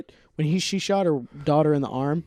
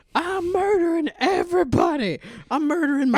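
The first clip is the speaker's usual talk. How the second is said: high, strained cartoon old man; mock sixty-two-year-old man; 13 semitones higher than usual. Old man ranting about his cousin, high mock voice. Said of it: "a sixty-two-year-old man was just like".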